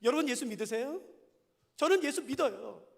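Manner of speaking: normal talking voice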